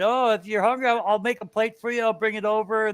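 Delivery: neighbor's offer. Retold or imitated. Neighbour offering to bring over a plate of food, high and friendly. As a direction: play the neighbour as sweet and obliging. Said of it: "he said".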